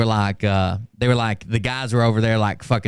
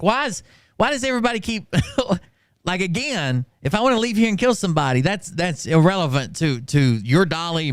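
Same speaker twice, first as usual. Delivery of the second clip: exasperated retort; 6 semitones higher than usual. Retold or imitated. Exasperated retold reply.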